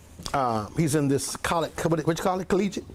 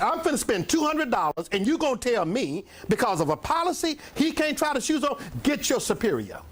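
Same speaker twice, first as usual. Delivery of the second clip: indignant tone; 7 semitones higher than usual. Retold or imitated. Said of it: "yes, I was, in that tone of voice".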